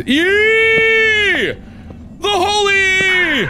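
high, held-out cry of triumph; triumphant shout. Real, not acted. Gamer hails the letter E as holy, triumphant shout. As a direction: hail the letter like a holy relic.

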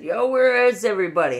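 high, loud "yo" across the bar; loud call out. Retold or imitated. Drunk me back at the bar calling out, high loud yell. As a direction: shout into the room looking for friends.

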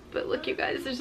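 whisper. Real, not acted. Showing the hidden cardinal.

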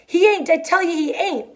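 high-pitched, desperate protest; desperate denial. A narrator's reading. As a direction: insist in a high, pleading voice. Lenny desperately drowning out the rabbit.

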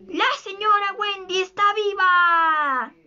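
high, drawn-out storybook shout; crying out. A narrator's reading. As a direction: proclaim it high, stretching the last word. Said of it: "Snively gritó al instante".

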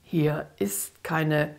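slow, menacing demon voice, chopped into beats; a cold, clipped threat from something inhuman; dark, threatening staccato. Acted. Demon answers the frightened girl, slow and menacing. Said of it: "kam es mit dunkler, staccatohafter Stimme bedrohlich zurück".

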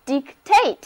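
"dictate" with the stress on TATE; stress on second syllable. Shown as a demonstration. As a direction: say "dictate" as a model, leaning on the final syllable. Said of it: "重音放在第二个音节". (“dictate”重音放在第二个音节tate上)

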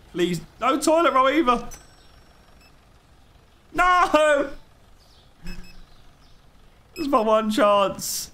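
high whiny mock begging; mock panicked pleading. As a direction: plead in a squeaky, desperate voice, slowing at the end. Begging customers not to want items.

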